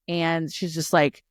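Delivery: ordinary talk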